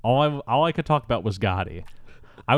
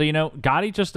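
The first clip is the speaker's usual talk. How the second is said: ordinary delivery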